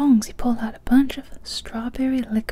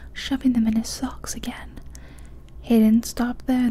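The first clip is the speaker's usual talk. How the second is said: ordinary delivery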